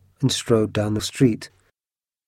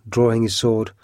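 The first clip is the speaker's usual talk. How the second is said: ordinary delivery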